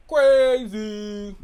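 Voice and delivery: loud, drawn-out "crazy"; exaggerated crazy. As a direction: belt the word out, stretched long.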